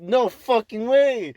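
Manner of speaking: high, loud, slow disbelief; mock shocked disbelief. Told the lady was a friend's cousin, loud and shocked.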